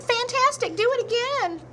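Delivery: very high, thrilled cry; squealing excited shout. A narrator's reading. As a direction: egg him on with delight. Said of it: "but Elizabeth shouted".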